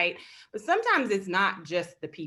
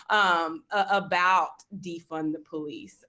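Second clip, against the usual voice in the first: ordinary talk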